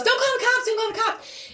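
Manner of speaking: high-pitched mimicked begging; imitated panicked plea. Mother begs, voiced by teller.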